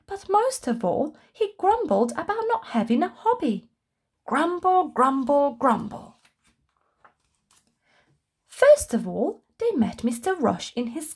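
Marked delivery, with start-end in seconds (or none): none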